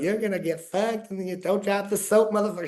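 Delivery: imitating a high-pitched taunt; mock taunting. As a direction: jeer it as a retold threat, pitched well up. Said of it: "screaming at me, taunting me".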